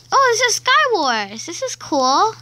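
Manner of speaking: loud, high thrilled discovery; excited. Real, not acted. Kid finding Sky Wars, loud excited cry. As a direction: burst out with delight.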